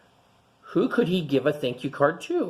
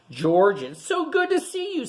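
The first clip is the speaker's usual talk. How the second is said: bright, higher welcoming greeting; happy greeting; 10 semitones higher than usual. Acted. Museum director welcoming George back, delighted high greeting. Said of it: "said Doctor Lee".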